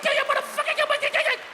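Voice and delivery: very high-pitched rapid-fire threats; comic high-pitched cursing fit; mock furious rant. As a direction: shriek threats like an unhinged parent. Acted furious wife threatening kids.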